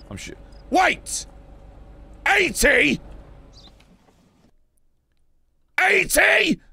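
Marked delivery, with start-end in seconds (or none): 0.7-1.3 high startled call; sudden alarm. Real, not acted. Panicked at border booth game. 2.3-6.6 high incredulous shout; can't believe the number; shocked disbelief. Real, not acted. Shocked at fine amount.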